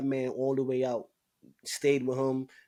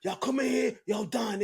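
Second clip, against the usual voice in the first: retold yelling inmate; 10 semitones higher than usual. Big inmate yelling again.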